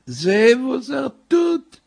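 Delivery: very high, slow, tearful wail; drawn-out plaintive cry, way up high; about to cry. Acted. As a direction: whine it out on the verge of weeping. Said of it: "will sich zu weinen".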